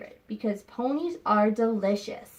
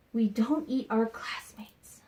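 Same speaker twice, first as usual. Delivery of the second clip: breathy unvoiced whisper, barely there; 5 dB quieter than usual. Teacher whispering a hint to her students, hushed. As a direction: tease the line in a secret whisper.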